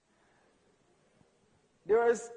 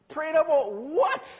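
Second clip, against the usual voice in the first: slow, louder, baffled "what"; incredulous; 7 semitones higher, 7 dB louder, slower than usual. Retold or imitated. Son hearing mother prayed over his bottle, slow incredulous question. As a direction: disbelief stretched out.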